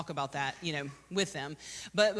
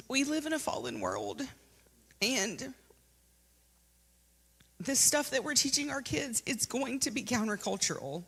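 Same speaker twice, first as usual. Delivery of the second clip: getting emotional; 7 semitones higher than usual. Real, not acted. Youth leader telling parents about a death, emotional. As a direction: voice lifting and breaking with emotion. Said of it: "I'm getting emotional".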